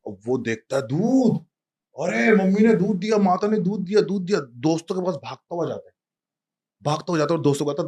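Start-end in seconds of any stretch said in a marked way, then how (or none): 0.8-4.4 put-on high, loud childlike delight; overjoyed child. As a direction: squeal with joy over the milk. (बच्चे की ऊँची, चहकती ख़ुशी)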